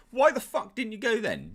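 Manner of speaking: high, loud incredulous outburst; fed-up shout; exasperated yell. Real, not acted. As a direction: yell at the screen. Yelling at character onscreen.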